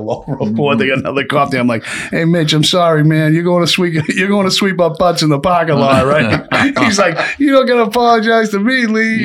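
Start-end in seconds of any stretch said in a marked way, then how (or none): 1.9-6.5 high, laughing fake-sorry voice; mock apology. Mock apology to fired guard. 7.4-9.2 high, laughing voice; laughing, retelling the guard's reply; laughing through quote. Retold or imitated. Guard replies while teller laughs.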